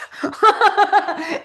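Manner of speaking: bright burst of laughter, high. Real, not acted. Laughing at herself after losing her thread. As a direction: laugh at her own rambling.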